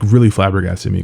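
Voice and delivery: ordinary talk, nothing marked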